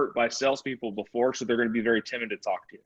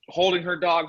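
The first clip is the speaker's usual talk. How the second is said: ordinary talk, nothing marked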